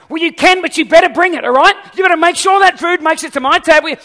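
loud, high-pitched mock outburst; demanding. Diner warning the waiter about the food, loud mock demanding. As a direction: play an overbearing diner laying down the law.

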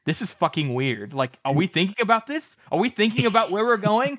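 incredulous rant. Retold or imitated. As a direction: work yourself up in disbelief. Glock voiced ranting in book recap.

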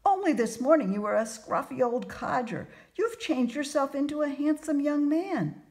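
high, loud, amazed gushing; astonished outburst. A narrator's reading. Merchant's wife marvels at the changed fortune teller, astonished outburst. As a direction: exclaim it in wide-eyed wonder. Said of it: "she burst out".

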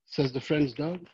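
ordinary delivery

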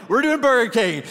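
high, loud cheer; excited declaration. Retold or imitated. Pastor cheering the Burger King pick, excitedly. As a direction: announce it like a victory. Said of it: "So we're like".

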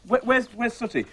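usual speaking voice

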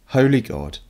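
ordinary talk, nothing marked

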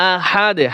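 আহাদে cut to "ahad" with a short bouncing d; qalqalah on d. Shown as a demonstration. Teacher showing qalqalah on dal, bounced final d. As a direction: stop on আহাদে, letting the d echo briefly. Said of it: "কলকলা হবে".

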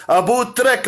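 ordinary delivery

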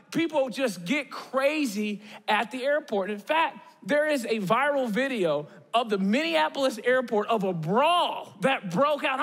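normal talking voice

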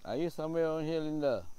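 calling loudly for a spirit. Real, not acted. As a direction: call out across the graveyard. Calling out again.

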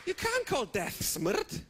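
slow words pushed through real laughter; can barely get it out for laughing; slowed, giggling disbelief. Real, not acted. Repeating it, laughing.

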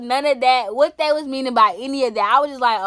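normal talking voice

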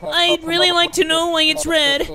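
high, loud put-on wimp voice; wimpy whiner. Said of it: "I made him sound like a little bitch".

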